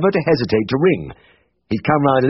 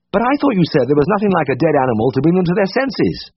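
querulous; 7 semitones higher than usual. A narrator's reading. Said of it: "I said, querulously".

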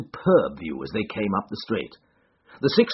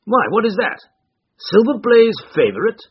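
higher, louder eager questions; eager Colonel; 11 semitones higher, 7 dB louder than usual. A narrator's reading. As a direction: ask keenly about the favourite. Colonel eagerly asks about odds.

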